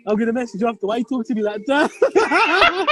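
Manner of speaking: laughing through the words. Real, not acted. Pal laughs back after being snapped at, laughing.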